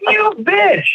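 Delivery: very high-pitched indignant squeal; mock outrage. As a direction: act out the outraged comeback, way up high. Repeats the acted angry comeback.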